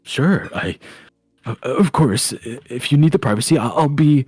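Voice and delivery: uncomfortable, halting politeness; flustered Ismark. Acted. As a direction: agree awkwardly, tripping over your words. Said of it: "very awkwardly leaves".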